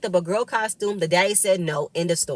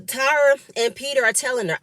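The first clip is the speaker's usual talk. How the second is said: normal talking voice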